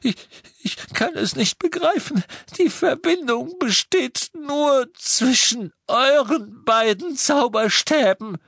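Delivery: desperate pleading. A narrator's reading. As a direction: plead for your life. Prisoner pleads ignorance. (hohe, verzweifelte, flehende Stimme)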